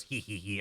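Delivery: soft, low little hee-hee; mock furtive snicker; sneaky giggle. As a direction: giggle quietly to yourself while hiding. Snake hiding in a box.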